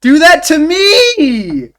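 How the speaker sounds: high, slow, loud swooning cry; swooning squeal. Real, not acted. Gushing at the handsome game character, loud slow swoon. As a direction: melt at him out loud.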